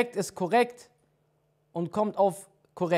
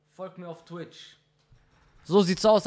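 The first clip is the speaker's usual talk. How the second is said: normal talking voice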